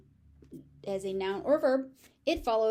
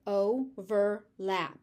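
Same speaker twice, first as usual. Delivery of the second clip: „overlap“ slowly, stress on OVER; slow syllable demo; much slower than usual. Shown as a demonstration. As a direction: draw out OVERlap. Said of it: "So noun".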